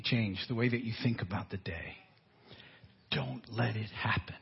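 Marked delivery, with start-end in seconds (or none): 3.1-4.3 near-whisper, very low and soft; hushed warning. Real, not acted. Preacher warning listeners about fearful headlines, hushed. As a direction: drop to a whisper to caution them.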